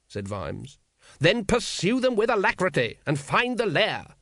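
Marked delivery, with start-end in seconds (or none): 1.2-4.2 high-pitched, sour order. A narrator's reading. Patrician orders the captain to find the lair, sour. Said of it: "said the patrician sourly".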